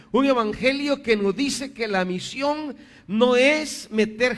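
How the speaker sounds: high-pitched preaching, building in volume; emphatic preaching. Real, not acted. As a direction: drive the point home, rising to a loud peak. (prédica enfática, aguda y cada vez más fuerte)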